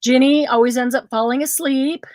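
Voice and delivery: ordinary talk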